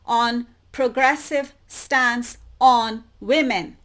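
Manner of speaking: slow loud emphatic phrase; emphasized phrase. Shown as a demonstration. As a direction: lean on progressive stance on women. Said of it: "The emphasis here that I want to put on".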